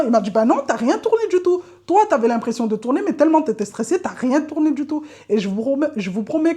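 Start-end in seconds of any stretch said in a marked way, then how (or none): 0.3-5.0 higher, louder mock scolding; exasperated instructor. Driving instructor telling her she never turned the wheel, exasperated. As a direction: set her straight, exasperated.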